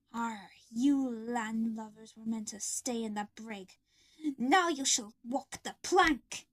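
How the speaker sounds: slow menacing pirate growl; slow, threatening pirate robot; sinister pirate. Acted. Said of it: "Foxy said sinisterly from behind us".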